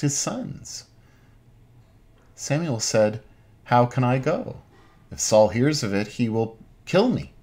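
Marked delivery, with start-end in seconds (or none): none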